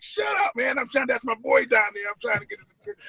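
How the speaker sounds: high, strained mock yell; yelling at hecklers. Fan yelling at New York hecklers to hush. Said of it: "And I'm like".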